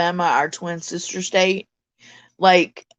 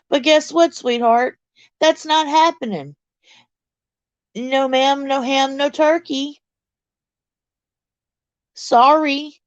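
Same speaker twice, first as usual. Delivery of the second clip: high, singsong mock-sweet telling-off; playful sassy refusal; 7 semitones higher than usual. Telling Rainy no boyfriend or girlfriend, playfully sassy and high. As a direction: turn them down sweetly in a raised, teasing voice.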